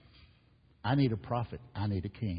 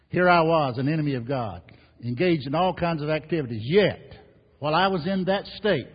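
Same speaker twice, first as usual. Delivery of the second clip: higher and much louder, building; preaching at full force; 5 semitones higher, 8 dB louder than usual. Acted. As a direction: proclaim it to the back row. Preacher climax reading scripture.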